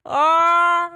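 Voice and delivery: a high, strangled squeal of secondhand embarrassment; cringe squeal. Real, not acted. Viewer cringing at the couple, high squeal. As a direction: squeal like you can't bear to watch.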